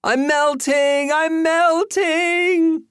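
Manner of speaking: high, drawn-out wailing cry; cri théâtral. A narrator's reading. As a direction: cry it out like a melting witch, stretched long and shrill-high. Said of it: "cried Joey".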